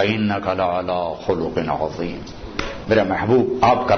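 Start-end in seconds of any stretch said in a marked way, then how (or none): 0.0-2.2 quran recitation. Acted.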